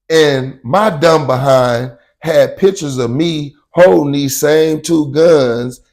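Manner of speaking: usual speaking voice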